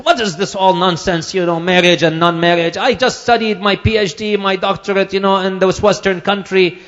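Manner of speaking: high, loud dismissive voice; mock the educated man's scorn; arrogant doctor. Preacher voicing the doctor scoffing at the scholar, high and loud. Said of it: "he talks to this alim and he says".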